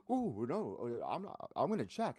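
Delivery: high, hammed-up whiny fake reluctance; mock the opponent's act; mocking imitation. Teasing the opponent by imitating his fake check, mocking. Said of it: "imitating him".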